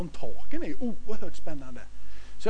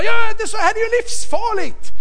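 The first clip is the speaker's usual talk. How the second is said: much higher, alarmed pleading; alarmed wife; 3 dB louder than usual. Retold or imitated. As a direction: mimic his worried wife, shrill with alarm. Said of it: "hon står och hyttar med fingret".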